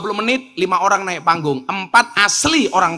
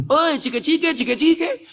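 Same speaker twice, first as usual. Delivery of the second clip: high, rising mock call; imitated high calling; 10 semitones higher than usual. Man calls at the preacher's hotel room, high mock calling. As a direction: pitch it high, lifting each phrase at the end.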